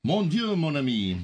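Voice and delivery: hammed-up French exclamation; mock French accent.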